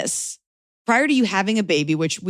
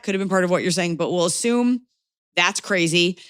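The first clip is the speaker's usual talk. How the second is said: usual speaking voice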